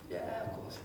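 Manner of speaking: low, soft, nonchalant reply; mock-cool, deep and quiet agreement; playing it cool. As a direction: act casually unimpressed, dropping your voice. Playing cool about the helicopter.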